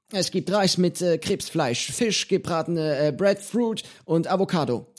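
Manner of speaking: menu listed in Johnny's high voice, hesitant. Acted. Johnny telling the boy the dinner, high and halting. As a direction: list the dishes as the old pirate.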